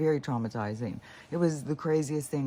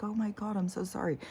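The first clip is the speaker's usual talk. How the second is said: higher, sorry tone to the dog; apologetic; 9 semitones higher than usual. Retold or imitated.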